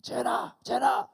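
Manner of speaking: loud, high repeated summons; re-enacted dad's shout; angry calling. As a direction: holler the kid's name, angry. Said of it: "I was so angry".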